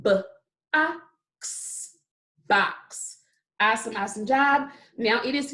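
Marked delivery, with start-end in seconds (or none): 0.0-2.0 b, ah, ks as separate sounds for BOX. Shown as a demonstration. Teacher showing the sounds in box, each said separately. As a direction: break BOX into b... ah... ks. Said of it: "tell me the sounds that you hear".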